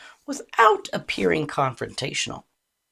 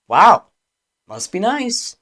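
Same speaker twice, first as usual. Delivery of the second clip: loud, pointed mock-impressed jab; biting fake admiration, pitched up; sarcastic; 6 semitones higher, 9 dB louder than usual. Shown as a demonstration. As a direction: say it as playful sarcasm with tension underneath. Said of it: "the words might sound playful, but the tone carries tension".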